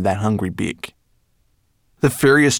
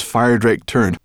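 ordinary delivery

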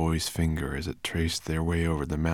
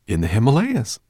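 eager raised voice, louder and higher; excited cry; 6 semitones higher, 8 dB louder than usual. A narrator's reading. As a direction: Ben blurts it out, thrilled. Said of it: "cried Ben".

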